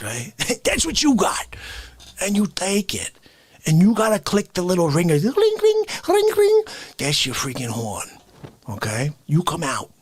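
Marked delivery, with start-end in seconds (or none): 5.1-6.6 high sing-song ring ring; mock bell ring. Imitating the scooter's little bell, high ringing. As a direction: chime it like a toy bell, high.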